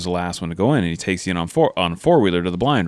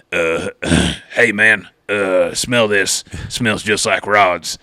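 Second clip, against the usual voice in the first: slow, gravel-gargling man's voice; rough, gravelly impression, unhurried; Corey Crane; much slower than usual. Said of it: "it's a spot on Corey Crane impression".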